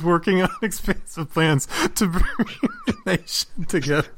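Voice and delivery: reading it while giggling; quoting through laughter; laughing quote. Retold or imitated. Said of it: "is when he says".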